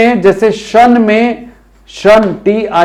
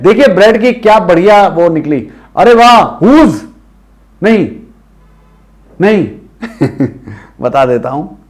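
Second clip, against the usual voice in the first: ordinary talk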